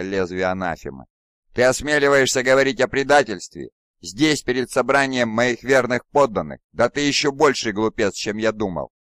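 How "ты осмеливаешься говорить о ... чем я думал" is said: furious. A narrator's reading. Primarch furious at commander.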